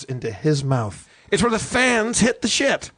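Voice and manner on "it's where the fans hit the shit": ordinary delivery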